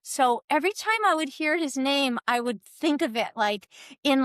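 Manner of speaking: usual speaking voice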